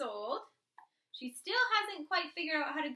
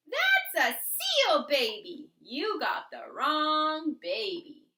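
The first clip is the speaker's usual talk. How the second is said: slow insistent scolding; 2 semitones higher, 7 dB louder, much slower than usual. A narrator's reading. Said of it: "Kristen said".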